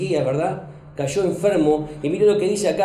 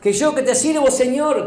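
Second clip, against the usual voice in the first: higher, louder indignant protest; protesting to God; 8 semitones higher, 4 dB louder than usual. Pretend. The wife as she might have rebelled against God, indignant. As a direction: voice a wounded complaint at heaven. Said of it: "Como, ¿no?".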